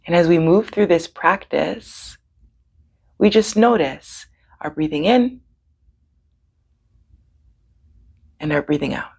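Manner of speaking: calm breathing guidance with long pauses; guided meditation. Real, not acted. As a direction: lead them gently, leaving room for each breath.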